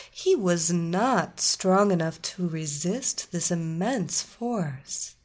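ordinary delivery, nothing marked

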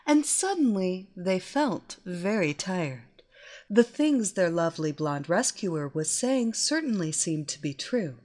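usual speaking voice

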